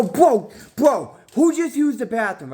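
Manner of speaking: high, loud mock outrage; high squeaky retold outrage; outraged high voice. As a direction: demand an answer in a high squeaky voice. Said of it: "I go".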